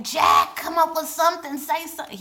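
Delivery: high, loud plea at the screen; shouting at the TV for Jack to answer; urging Jack aloud. Real, not acted. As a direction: beg him to say something, loud and high. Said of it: "And I was like".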